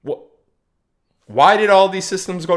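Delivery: pointed question. Retold or imitated. Asking the question nobody asked about the bank outage, loud and pointed. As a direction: demand it as if nobody else thought to ask. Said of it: "No one stopped to ask the question".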